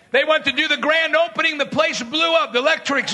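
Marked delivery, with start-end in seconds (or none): none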